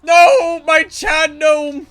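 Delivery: high, loud drawn-out wail; mock anguish. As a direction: cry out in hammed-up dismay. Mock lament for fallen gnome.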